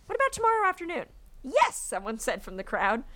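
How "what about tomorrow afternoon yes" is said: high, quick mock enthusiasm; eager townspeople. A narrator's reading. Townspeople agreeing on a time, eager high voice. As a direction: pipe up keenly, pitched way up.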